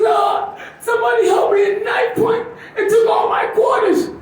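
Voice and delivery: high-pitched, upset mother's voice; shrill mom voice. Retold or imitated. Comic's mom telling him about robbery, high and upset. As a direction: squeal it like a shaken mom.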